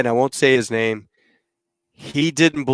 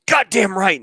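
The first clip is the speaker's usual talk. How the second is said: higher-pitched, mildly angry comeback; upset retort; 7 semitones higher than usual. Retold or imitated. Tony answering the captain after the vote, upset. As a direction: retell it as a miffed retort. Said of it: "you're a little upset".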